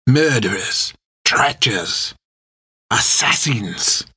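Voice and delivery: put-on drawn-out French-accented relish; savouring each word. A narrator's reading. Captain lists his prisoners' crimes, savouring them in French accent. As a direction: roll each word off the tongue with pauses. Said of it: "He rolled the words off his tongue, enjoying the taste".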